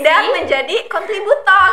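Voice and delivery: slow words through laughter; laughing. Real, not acted. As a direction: laugh while speaking slowly. Laughs over her rolled Rs.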